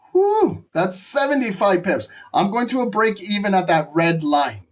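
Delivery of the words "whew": amazed whew. Real, not acted. Profit jumps.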